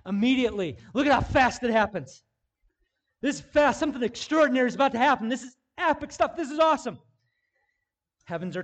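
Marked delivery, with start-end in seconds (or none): 0.1-7.0 higher, louder, fast thrilled build; excited preaching. Acted. Preacher thrilling the crowd over the scene. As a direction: sell how epic this is.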